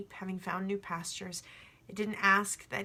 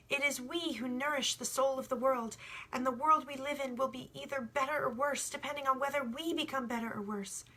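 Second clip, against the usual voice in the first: put-on higher, eager young shepherd explaining; young boy, earnest; 4 semitones higher than usual. The boy tells the sun about love, earnest and higher. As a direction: press the point as a sincere boy.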